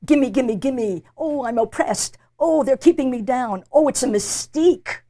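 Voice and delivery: loud, hammed-up whining complainer; mimicking an aggrieved feminist, loud mock whine; mocking whiner. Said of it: "You don't say".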